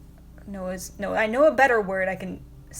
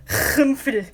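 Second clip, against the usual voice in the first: laugh; 4 semitones higher, 4 dB louder than usual. Real, not acted. Laughing at Swiss word.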